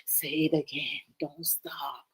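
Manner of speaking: low, hushed, whispery plea; mock swooning. Woman won over by naughty talk, mock swoon in a whisper. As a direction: melt into a playful near-whisper. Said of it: "you be like".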